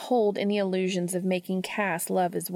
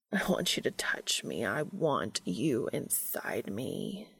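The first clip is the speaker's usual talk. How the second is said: low, slow and soft, intense; 8 semitones lower, 6 dB quieter, slower than usual. A narrator's reading. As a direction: hold his gaze and mean every word. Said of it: "the tone that Cass delivers those lines with is so intense".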